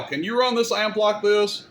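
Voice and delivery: ordinary talk, nothing marked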